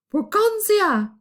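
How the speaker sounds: high, loud, slow shout. A narrator's reading. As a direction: yell to wake someone up. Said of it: "shouted at his sleeping wife".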